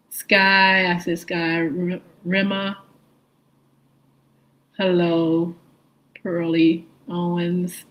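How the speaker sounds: normal talking voice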